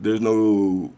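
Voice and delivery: usual speaking voice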